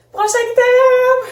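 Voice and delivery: high, loud, drawn-out announcement; excited. Real, not acted. Vlogger announcing the next item from her box, excited. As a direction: call it out in a slow, excited high cry. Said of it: "Je suis un peu excitée".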